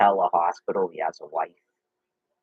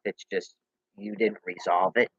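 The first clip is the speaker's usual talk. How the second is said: usual speaking voice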